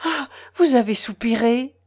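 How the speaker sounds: mock sigh, lightly exclaimed; mimicking exclamation. A narrator's reading. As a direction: tease him by aping his sigh. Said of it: "s'écria Maria Nikolaevna en contrefaisant Sanine". (exclamation moqueuse qui contrefait un soupir)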